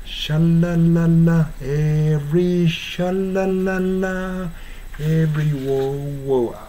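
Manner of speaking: slow "シャラララ" with clear English l; English l demo. Shown as a demonstration. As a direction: hold each "la" on the tongue tip, slowly. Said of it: "あくまでもLの発音ですね". (ゆっくり L の発音で「シャラララ」)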